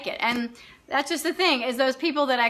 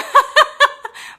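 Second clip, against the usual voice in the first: loud high burst of laughter; 13 semitones higher, 8 dB louder than usual. Real, not acted. Laughing hard at her own joke to the waiter. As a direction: break up laughing.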